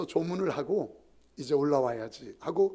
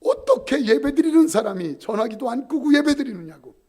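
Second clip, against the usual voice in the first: loud, raised scolding of the congregation; angry preacher; 5 semitones higher, 9 dB louder than usual. Retold or imitated. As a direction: scold them for the ringing phone. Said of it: "화를 내면서". (전화벨에 화난 부흥 목사, 큰 소리로 꾸짖듯)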